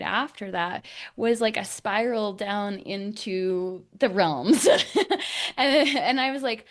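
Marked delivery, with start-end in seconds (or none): 4.7-5.5 high airy laugh. Real, not acted. Laughing at own spiral story.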